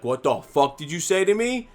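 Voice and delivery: imitating an affronted man, high and loud; a mock outraged challenge, rising; confrontational challenge. Lawn guy turns round on the speaker, confrontational challenge.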